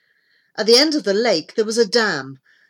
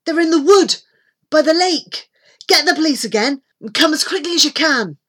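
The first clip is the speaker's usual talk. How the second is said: high, loud and hurried; pressing, raised boy's voice; urgent instructions; 9 semitones higher, 7 dB louder, faster than usual. A narrator's reading. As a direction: rattle off directions in an emergency. Boy urgently giving directions.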